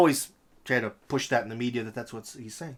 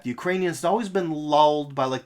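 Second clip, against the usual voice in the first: ordinary delivery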